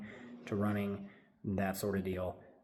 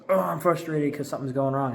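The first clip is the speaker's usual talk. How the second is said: higher, louder mock frustrated whine; frustrated self; 5 semitones higher, 7 dB louder than usual. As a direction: act out your own frustration, a bit hammed. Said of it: "and then I'm just like".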